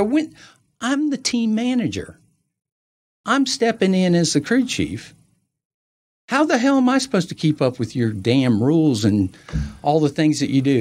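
acting out an aggrieved team manager; indignant protest. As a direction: argue back high and exasperated. Acted quarrel over rule change.

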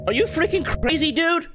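high-pitched rattled protest; high, indignant shock; startled outburst. Real, not acted. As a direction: protest right after a jump scare. Scolds game after jump scare.

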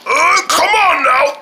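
high, slow, effortful groaned words; straining to lift. Pretend. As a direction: force the words out while heaving. Man straining at the weight.

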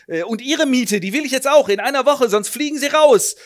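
high, loud landlady scolding; a landlady demanding rent; shrill threat. Acted. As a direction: threaten to throw him out.

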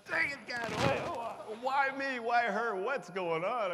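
high, loud pleading outburst at God; anguished cry to God. Retold or imitated. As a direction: cry out to heaven in mock despair. Retold anguished prayer, much higher, louder.

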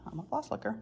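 sheepish admission. Retold or imitated. Admitting the accusation.

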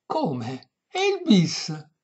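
high, stretched, afflicted protest; distressed exclamation. A narrator's reading. Old lady seeing the violin put away, distressed high cry. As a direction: wail it high in dismay. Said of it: "esclamò afflitta".

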